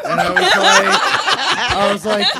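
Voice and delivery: high, loud laughing through the words. Real, not acted. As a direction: crack up while telling it. Laughing while retelling story.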